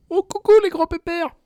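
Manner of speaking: high, loud cooing call; calling to dogs. Pretend. Host interrupts podcast for two dogs, high doting call.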